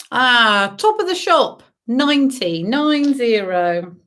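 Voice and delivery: sing-song number calling; bingo caller. Acted. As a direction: call each number out, drawn out. Calls bingo numbers to players.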